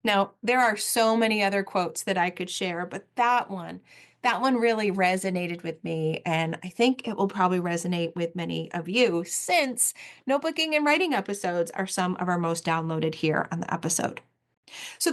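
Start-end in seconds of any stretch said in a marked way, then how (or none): none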